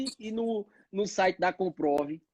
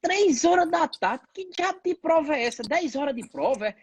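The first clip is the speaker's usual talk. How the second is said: scolding grandma; 7 semitones higher than usual. Retold or imitated. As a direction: scold like a startled grandma, pitched up.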